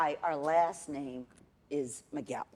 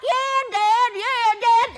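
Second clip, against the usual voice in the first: much higher, loud, hammed-up yeah dad; squeaky eager son parody; mocking a Yellowstone son, high and loud; 11 dB louder, much slower than usual. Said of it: "He's like".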